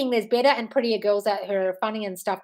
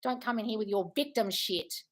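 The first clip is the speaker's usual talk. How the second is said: ordinary talk, nothing marked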